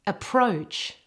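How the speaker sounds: "Approach" with all the weight on PROACH; teacher's careful "Approach", stressed at the end; stress on second syllable. Shown as a demonstration. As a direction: model "Approach", light first syllable, full gliding O in the second. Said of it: "all of the emphasis in this word is on the second syllable".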